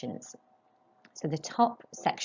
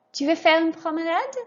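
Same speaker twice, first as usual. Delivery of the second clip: much higher, lifting on promenade; rising question tune; 10 semitones higher, 4 dB louder than usual. Shown as a demonstration. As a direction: model it high, ending up on promenade. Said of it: "raise your voice just before the question mark".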